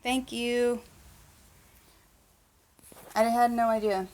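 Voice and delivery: ordinary talk, nothing marked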